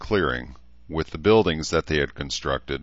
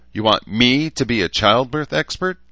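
higher, louder indignant question; out of temper; 8 semitones higher, 6 dB louder than usual. A narrator's reading. Daughter outraged at being made the childbirth expert, indignant. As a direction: fire it back, indignant. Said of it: "suddenly out of temper".

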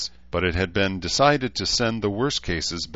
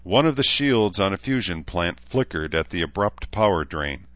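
usual speaking voice

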